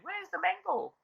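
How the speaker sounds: sudden high jump on where's the mango; startled realization, pitch shooting up; men suddenly noticing the mango is gone; surprised. Acted.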